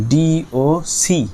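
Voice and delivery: spelled out slowly. Shown as a demonstration. Spelling out docs.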